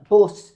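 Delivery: "bus" said loud with a rounded "oo" vowel; regional vowel. Shown as a demonstration. Showing the accent's vowel, "bus" with "oo". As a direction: hit "bus" hard, rhyming it nearer "puss". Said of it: "it's all those vowel sounds".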